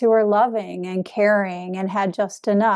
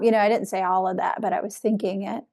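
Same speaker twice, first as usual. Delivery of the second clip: normal talking voice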